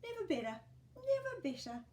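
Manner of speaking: put-on a winking old neighbour; old Fred, cheeky.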